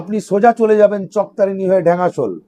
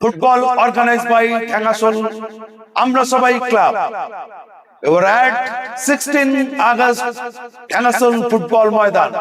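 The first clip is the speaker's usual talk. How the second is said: promo announcer. Acted. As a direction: sell it like a tournament promo. (Promo ঘোষকের ভঙ্গিতে)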